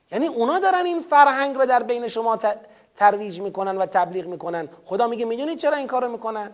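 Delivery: ordinary delivery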